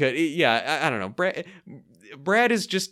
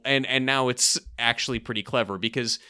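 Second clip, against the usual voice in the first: ordinary delivery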